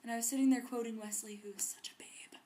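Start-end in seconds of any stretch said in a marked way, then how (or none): 1.4-2.4 soft whispered swoon; dreamy whisper. Acted. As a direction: breathe it like a secret crush. Swooning aside about Wesley.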